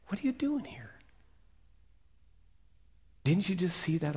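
gentle whispered voice of God; small still voice. Retold or imitated. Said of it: "in that small, still voice, God says".

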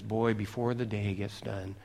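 low, soft menacing threat from a queen; a quiet villainous queen; a hint of a scheming queen; wicked queen. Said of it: "this one wicked queen says".

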